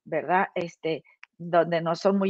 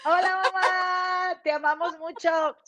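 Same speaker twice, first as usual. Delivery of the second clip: high sing-song greeting; 9 semitones higher, slower than usual. Real, not acted. Greeting mom on camera.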